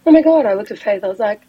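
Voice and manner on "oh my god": higher, louder gasped oh-my-god; retold amazement. Telling friends about getting fifty bucks, amazed. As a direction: play up the stunned reaction. Retold excited reaction to the cash.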